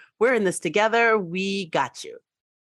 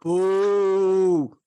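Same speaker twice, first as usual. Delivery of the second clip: long held boo; playful jeer. Pretend. Cohost joining a jeer at a rival march, playful booing. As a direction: join in booing, stretching the vowel. Joins the jeering.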